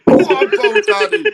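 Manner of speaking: high, loud whooping cheer; cheering shout. Real, not acted. Cheering on Bone Daddy before the fight, loud high whooping cheer. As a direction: egg him on with a big cheer.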